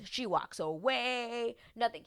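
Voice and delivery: normal talking voice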